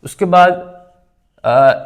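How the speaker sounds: ordinary talk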